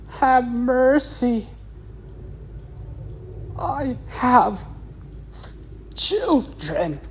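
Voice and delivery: high, strained pleading, slowing on "Have mercy"; begging for mercy. Retold or imitated. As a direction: beg for your life in a high, desperate voice. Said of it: "begging for mercy".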